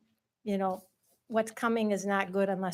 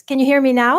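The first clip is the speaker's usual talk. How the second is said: much louder, projecting the question; louder into the mic; 3 semitones higher, 14 dB louder, faster than usual. Shown as a demonstration. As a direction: speak right up so the back row hears. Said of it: "Can you speak into the microphone".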